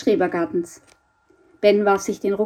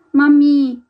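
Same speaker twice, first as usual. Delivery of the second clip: raised, insistent call for Mum; louder calling; 6 semitones higher, 8 dB louder than usual. Acted. Frightened boy calls his mother a second time, calling louder. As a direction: call out high and loud for Mami. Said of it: "rief er jetzt lauter".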